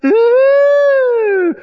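a long, high, loud ooh; the crowd seeing the bodybuilder take his shirt off, swooning. Retold or imitated. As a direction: swoon like the whole audience.

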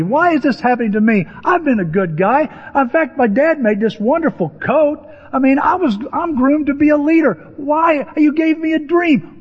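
high, mounting indignant plea; indignant protest. Acted. Acts Joseph's protest to God.

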